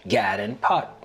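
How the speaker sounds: God said as Gad and Pat said as Pot; Gullah vowel swap. Shown as a demonstration. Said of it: "to sound like".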